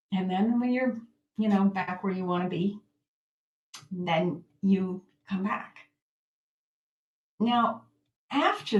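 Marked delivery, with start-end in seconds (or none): none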